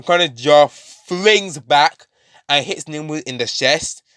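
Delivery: ordinary delivery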